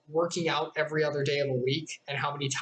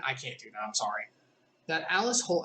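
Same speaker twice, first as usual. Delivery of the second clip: usual speaking voice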